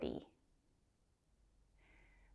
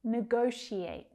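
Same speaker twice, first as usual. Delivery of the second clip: usual speaking voice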